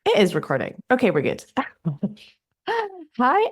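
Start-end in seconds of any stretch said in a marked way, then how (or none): 1.6-3.5 laughing. Real, not acted. Restarting the intro after a hiccup.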